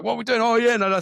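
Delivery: high, eager outburst; excited. Retold or imitated. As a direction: blurt it out like an excited child. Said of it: "it fucking excites me".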